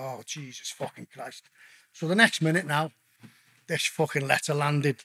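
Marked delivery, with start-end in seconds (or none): 0.0-1.5 exasperated mutter. Retold or imitated. Exasperated after the chairman's insult.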